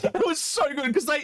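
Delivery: high laugh into the words. Real, not acted. Telling his mate the story, laughing high. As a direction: laugh as you tell it.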